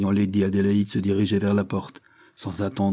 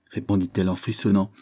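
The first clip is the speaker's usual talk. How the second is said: ordinary delivery, nothing marked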